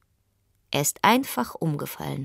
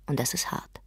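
unvoiced, soft whisper; 2 semitones lower, 5 dB quieter than usual. A narrator's reading. Narrator whispering about going back behind the pattern. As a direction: breathe it out as a weary secret.

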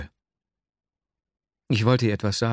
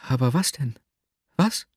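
higher heroine's voice, leaping up on the last question; puzzled curiosity; 4 semitones higher than usual. A narrator's reading. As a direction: press him, baffled and curious. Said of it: "starrte mich mit seltsamer Neugier an". (neugierig nachfragend, hohe Stimme)

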